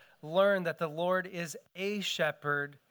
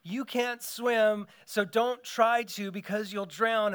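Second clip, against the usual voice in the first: higher, slow, cautious parent voice; imitating warning parents; 5 semitones higher, 5 dB louder, slower than usual. Pastor mimics parents warning little kids, higher and slow. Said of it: "parents their whole entire lives saying".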